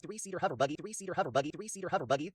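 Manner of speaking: rattled off fast, three times over; quick-fire repeated tongue twister; rapid tongue twister. Shown as a demonstration. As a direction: race through it like a tongue twister. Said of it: "Try to say that three times fast".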